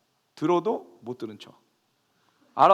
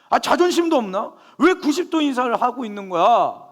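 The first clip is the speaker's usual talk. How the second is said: building from raised to loud, high indignant rant; growing indignant outburst, louder and higher; rising anger; 7 semitones higher, 7 dB louder than usual. Retold or imitated. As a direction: let the anger boil up line by line. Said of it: "분노 게이지가 올라오는 거죠".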